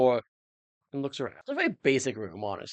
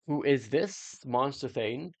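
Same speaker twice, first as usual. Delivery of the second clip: ordinary talk, nothing marked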